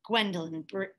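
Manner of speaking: ordinary delivery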